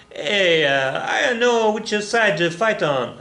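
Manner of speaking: hint of a hammed-up Italian accent; mock Italian accent, slow and unsure which side to fight on. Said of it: "I'm working on my Italian accent".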